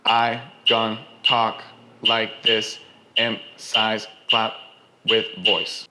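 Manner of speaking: slow rhythmic stress on each strike; clave-accented words. Shown as a demonstration. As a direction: tap out the clave with your voice. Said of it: "where they line up with the clave, they're louder".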